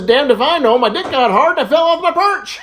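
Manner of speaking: loud, climbing to a high mock shout; exasperated punchline yell. Parrot answering Little Johnny's questions, yelling in mock exasperation. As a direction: blurt it out as a hammed-up outburst.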